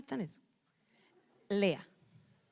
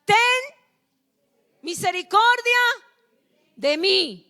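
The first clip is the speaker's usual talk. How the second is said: read aloud loudly; 9 semitones higher, 9 dB louder than usual. Shown as a demonstration. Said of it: "Léalo duro".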